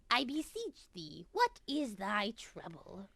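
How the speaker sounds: usual speaking voice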